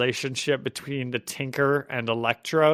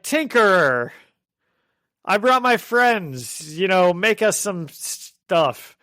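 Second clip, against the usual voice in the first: mildly raised, slow pleading villain; villain asking a favor; 6 semitones higher, 4 dB louder, slower than usual. Retold or imitated. Podcaster voicing villains asking the Tinkerer, slow and raised. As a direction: ask the Tinkerer for gear, drawn out.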